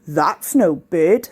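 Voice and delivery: a deep, slow Paul the penguin; low, slow penguin voice. A narrator's reading. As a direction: drag it out low and doubtful. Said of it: "said Penguin Paul".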